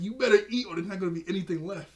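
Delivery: loud, high burst, then rushed; retold yell. Starfire yelling at Robin to eat, in a mock yell. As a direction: imitate her yell, hitting the opening hard. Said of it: "when she yelled at Robin".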